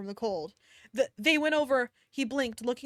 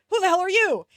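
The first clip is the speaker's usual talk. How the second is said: loud, higher angry demand; yelled; 7 semitones higher, 8 dB louder than usual. Acted. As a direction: yell it at the stranger. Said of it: "he yelled".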